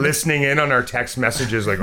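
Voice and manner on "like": usual speaking voice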